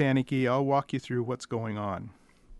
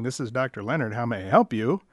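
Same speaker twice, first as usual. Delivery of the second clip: ordinary talk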